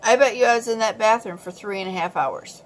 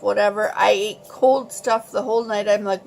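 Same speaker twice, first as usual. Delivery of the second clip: usual speaking voice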